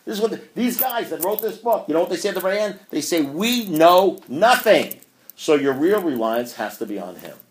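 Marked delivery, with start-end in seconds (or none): 3.2-5.0 slow, higher, every word weighted; nothing stretched out, said with a touch more force; drawn-out punchline. Acted. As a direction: let it land slowly. Dramatic punchline quote from book.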